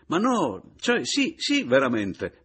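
high, loud, halting muddle; flustered stammer. A narrator's reading. Paleari answering the apology, flustered stammer. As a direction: stumble over it, flustered. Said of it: "balbettò il Paleari".

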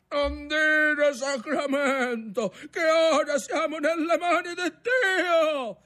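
high, drawn-out alarmed reproach; shouted rebuke. A narrator's reading. Grandfather scolding grandson's oath in the storm, shouting. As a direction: call out the rebuke over the gale.

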